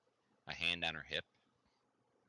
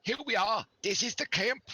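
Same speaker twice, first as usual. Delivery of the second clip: higher, louder cheerful guide; NPC guide voice; 7 dB louder than usual. Acted. The villager guide presents the camp to the party, in a bright raised voice. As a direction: proudly show off the camp.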